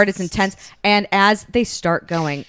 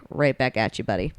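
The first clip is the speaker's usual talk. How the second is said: dry, lower comeback trailing off softer; sarcastic comeback; 6 semitones lower, 5 dB quieter than usual. Retold or imitated. She answers Tristan coolly, lower and sarcastic. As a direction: toss it back with a sneer. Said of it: "And she's like".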